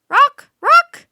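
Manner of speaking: very high, very loud call of the name. Acted. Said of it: "She called up to the bird".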